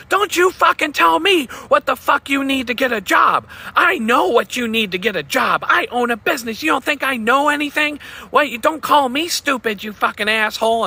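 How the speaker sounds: ex-girlfriend screaming that she knows about jobs, imitated furious yell; imitating her screaming. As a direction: play the ex-girlfriend raging and insulted. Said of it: "this job that you're screaming and yelling at me about".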